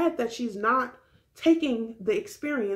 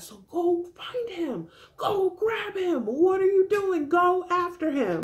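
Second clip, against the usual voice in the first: higher, slow, pleading push; will her off the couch; exasperated urging; 9 semitones higher than usual. Real, not acted.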